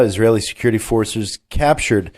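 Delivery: ordinary delivery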